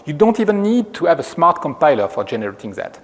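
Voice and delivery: ordinary delivery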